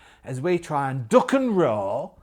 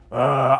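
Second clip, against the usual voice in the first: mummy groan; 7 dB louder than usual. Acted. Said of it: "And he goes".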